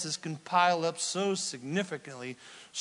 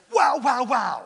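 excited wow; 9 semitones higher, 9 dB louder than usual. Acted. Jokes about highlighter colours.

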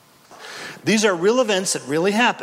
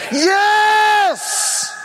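much higher, louder yes shout; exultant shout; 5 dB louder than usual. Real, not acted. Preacher leading the answer, exultant shout.

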